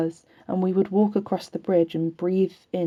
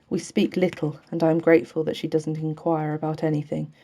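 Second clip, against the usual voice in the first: ordinary delivery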